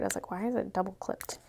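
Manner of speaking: ordinary delivery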